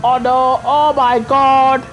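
loud, drawn-out groan of dismay; real exasperated cry. Real, not acted. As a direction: stretch it out slowly as it all goes wrong. Dismay at mishap in race.

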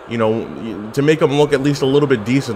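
ordinary delivery

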